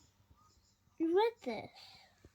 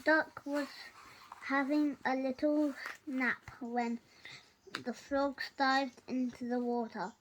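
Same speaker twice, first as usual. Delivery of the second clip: ordinary delivery, nothing marked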